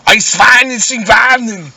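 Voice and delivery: high, loud, slow fake German barking; mock German. A comedian mimics a German dog in loud, slow fake German. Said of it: "German dogs just go".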